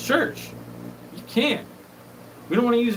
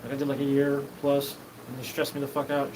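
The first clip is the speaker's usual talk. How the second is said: usual speaking voice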